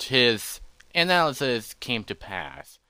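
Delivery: ordinary delivery, nothing marked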